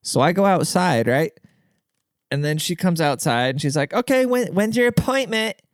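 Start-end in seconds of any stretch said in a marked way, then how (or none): 3.9-5.6 high, curt and dismissive; rude receptionist. Retold or imitated. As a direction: snap it like a rude clerk. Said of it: "Like, super rude".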